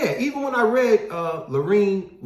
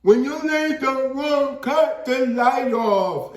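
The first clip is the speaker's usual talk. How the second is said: very slow, higher and louder off-tone speech; imitating deaf speech; 8 semitones higher, 4 dB louder, much slower than usual. Host acting a deaf person asking to cut the light off, slow and off-tone. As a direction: draw every word out slowly like you can't hear yourself. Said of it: "They'd be like".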